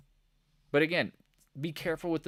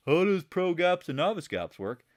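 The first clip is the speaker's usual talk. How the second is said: mocking question; 5 semitones higher, 5 dB louder than usual.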